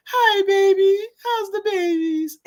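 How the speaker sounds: high, drawn-out hospital-bed greeting; imitating a wife's sing-song hello; imitated wife. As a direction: voice the wife in a sustained high pitch. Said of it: "She goes".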